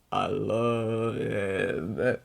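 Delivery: put-on very slow, soft drunken sigh. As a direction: slur it out lovingly. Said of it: "I'm so drunk right now".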